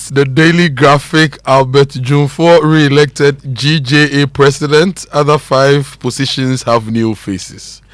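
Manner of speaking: projected press-review reading; headline read. Acted. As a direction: announce the front-page headlines with force. Reads newspaper headlines.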